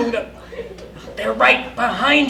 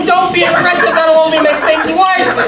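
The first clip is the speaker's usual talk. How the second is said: loud, higher urgent plea; urgent shout; 5 semitones higher, 7 dB louder than usual. Acted. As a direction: shout to stop him. Urgently stopping Chad.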